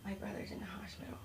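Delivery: imitated child whispering a secret; very soft, whispered into an ear; kid's whisper. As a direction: whisper it like a little kid confiding. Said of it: "they just whisper in my ear".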